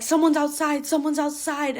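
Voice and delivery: imitating friends blurting out their fear; panicked warning. Friends warning the group at the party house, panicked.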